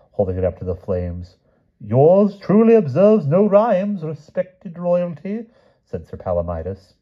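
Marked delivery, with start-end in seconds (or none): 1.8-5.4 high, slow, drawn-out knightly objection; formal objecting knight. A narrator's reading. Sir Palomides corrects the king's sonnet, high and formal. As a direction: object loftily in a high, stretched voice.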